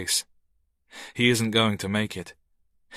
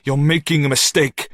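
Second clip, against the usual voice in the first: pressed through gritted teeth, a touch louder; 5 semitones higher, 5 dB louder than usual. A narrator's reading. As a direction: hold back anger through clenched teeth. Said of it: "says through gritted teeth".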